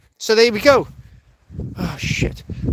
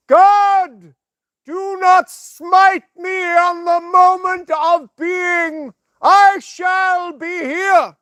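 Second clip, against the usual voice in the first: hammed-up preaching Jesus, higher, slow and booming; Jesus impression; 7 semitones higher, 3 dB louder, much slower than usual. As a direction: proclaim like a mock messiah to the masses. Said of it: "Let's do a bit of Jesus".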